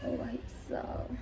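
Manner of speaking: breathless hushed oh brother; whispered exclamation. Real, not acted. Telling viewers about the uncle, whispered exclamation. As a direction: drop to a whisper of disbelief. Exclaiming at what happened downstairs.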